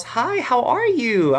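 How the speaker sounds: high, drawn-out imitated greeting; lifted voice for the star's hello; Jennifer greeting a fan. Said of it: "And she goes".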